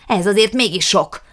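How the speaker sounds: loud repeated protest; indignant shout. A narrator's reading. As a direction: press the complaint home, loudly. Jukrics raging about lost dogs.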